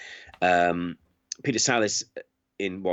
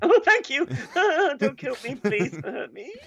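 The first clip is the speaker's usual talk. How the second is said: very high, squeaky frightened begging; hammed-up scared squeal to a stranger; terrified pleading. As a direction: beg for your life in falsetto. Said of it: "But outside, I went".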